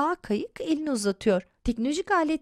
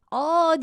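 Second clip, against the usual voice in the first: high, louder cry of fright; narrated boy's yell; acted scream; 6 semitones higher, 5 dB louder than usual. A narrator's reading. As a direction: cry out wide-mouthed in terror. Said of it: "diye bağırırken".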